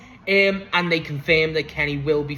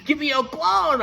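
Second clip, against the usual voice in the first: much higher, slow, drawn-out call; giddy fan cheer; 11 semitones higher, slower than usual. Real, not acted. Fan wanting El Clon in the team, giddy cheer. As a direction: sing out the demand, tickled by it.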